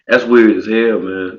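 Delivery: normal talking voice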